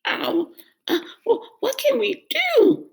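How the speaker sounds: high, hesitant goat voice; a small nervous goat voice; worried middle goat. Acted. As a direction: stammer it out, fretting.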